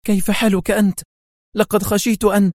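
high, heartfelt greeting; eager, high-pitched concern; fervent. A narrator's reading. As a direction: exclaim it with relief and fervour. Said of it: "وهي تهتف في حرارة".

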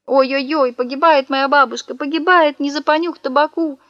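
keen like a hammed-up mourner; theatrical sing-song sobbing; fake wailing. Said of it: "снова зарыдал".

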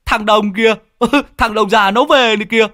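high gleeful laughing shout. Acted. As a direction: giggle out the news, delighted. Sẹo sees Đồng, eyes light up.

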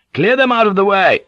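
loud, high shouted order; full-voiced command to attack; shouting. A narrator's reading. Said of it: "Kazuko shouted".

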